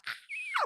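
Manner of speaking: imitating a fan's high-pitched squeal; excited squeal. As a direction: squeal up high like a starstruck fan. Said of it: "Vivian went a little bit like".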